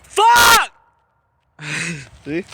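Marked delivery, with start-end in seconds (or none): 0.0-0.7 shouting. Real, not acted. Yelling into woods for echo.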